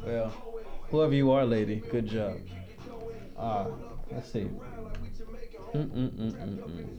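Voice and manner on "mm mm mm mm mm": ordinary delivery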